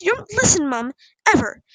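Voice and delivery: loud, exasperated accusation; shouting. A narrator's reading. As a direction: hurl each phrase at a parent. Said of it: "Poppy shouted".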